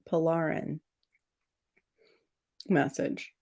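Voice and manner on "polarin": Polarin said "po-LAR-in"; Polarin, middle syllable like "lar"; alternative pronunciation. Shown as a demonstration. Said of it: "do I say that Polarin".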